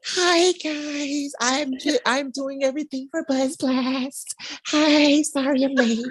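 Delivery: high, bubbly imitation of Amelia; sing-song late apology, mocking her; mimicking Amelia. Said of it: "She's all like".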